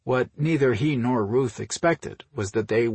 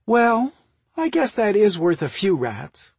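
high, louder grudging concession; 9 semitones higher, 6 dB louder than usual. Acted. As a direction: give in grudgingly, pitched high and loud. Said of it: "she grudgingly replied".

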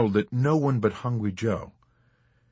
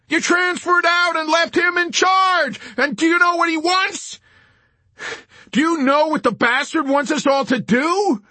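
furious, panicked yelling; enraged shriek; 6 dB louder than usual. A narrator's reading. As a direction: scream the blame down the phone. Wintergreen shrieking back by phone.

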